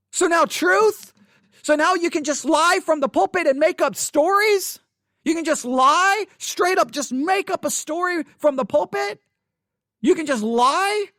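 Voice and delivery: high, loud incredulous challenge; heated retort. Retold or imitated. To the pastor after the sermon, loud incredulous confrontation.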